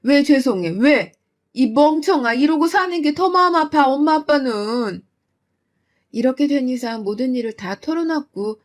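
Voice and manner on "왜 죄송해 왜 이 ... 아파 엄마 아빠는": loud, higher heartbroken scolding; mother yelling out of hurt; angry mother. Acted. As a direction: call her a fool and mean it.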